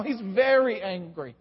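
slow down and weigh "very"; stress on very. Shown as a demonstration. Preacher stressing God's anger to the congregation, weight on "very". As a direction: lean on "very" in "he's very angry". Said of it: "He's not just angry now".

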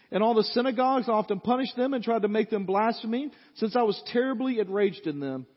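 loud, high preaching of the text; booming pulpit reading; preaching scripture. Acted. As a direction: proclaim it to the whole church. Preacher reads scripture at full force.

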